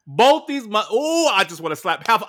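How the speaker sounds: loud, high indignant rant; outraged. Real, not acted. Host vents to cohost about the heckler, loud outrage. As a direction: let the outrage boil over.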